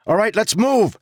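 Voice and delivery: high, loud command; ordering. Acted. Jarek ordering the bridge crew forward, loud command. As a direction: snap the order to move. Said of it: "Jarek ordered".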